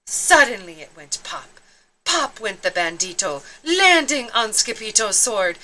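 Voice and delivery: plain reading voice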